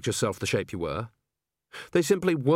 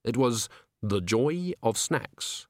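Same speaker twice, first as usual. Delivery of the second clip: plain reading voice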